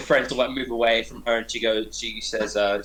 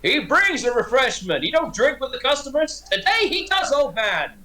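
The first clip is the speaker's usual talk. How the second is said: high American barkeep voice; hammed-up American bartender, pitched high; American accent; 12 semitones higher than usual. Bar owner answering the orc, high American accent. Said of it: "he's got the American accent".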